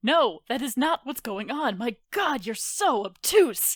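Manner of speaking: heated, incredulous rant; exasperated scolding. Acted. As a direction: berate them for being dense. Acted quarrel, insulting the other.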